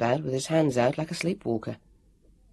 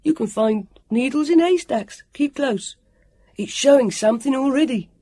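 put-on high, excited child's call; 10 semitones higher, 5 dB louder than usual. A narrator's reading. Sam leading the search, calling out excitedly. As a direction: urge them on in a loud boy's voice. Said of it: "he called out".

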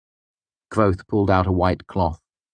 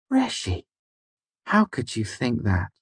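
high-pitched, mildly affronted protest; slightly offended; 5 semitones higher than usual. A narrator's reading. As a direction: start high on the name, gently wounded. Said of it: "his voice slightly offended".

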